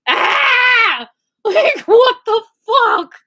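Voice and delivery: high, loud scream half laughing; mock panicked scream. Locked in for twelve hours, high loud mock panicked scream. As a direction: shriek in retold panic at the slammed door.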